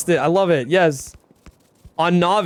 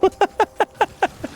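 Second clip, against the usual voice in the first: quick giggle, much higher; 13 semitones higher than usual. Real, not acted. Laughing at chat.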